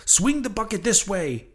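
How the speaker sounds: high called-out order; urgent command. A narrator's reading. Harpooner ordering the bucket swung over, urgent call. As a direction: call it across the deck. Daggoo directing the rescue.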